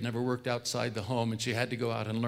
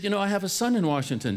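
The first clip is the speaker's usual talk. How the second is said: high and quick, lightly voiced old mother; a hint of a chatty, forgetful mother; old mother's voice; 7 semitones higher, 4 dB louder, faster than usual. Mother telling her son about her son, lightly voiced high.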